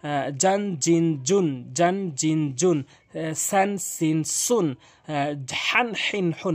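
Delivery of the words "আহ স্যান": ordinary delivery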